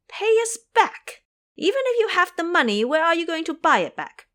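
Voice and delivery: high, loud, scoffing; boy mocking repayment; scornful boy. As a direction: sneer at the offer. Ming Yue scoffs.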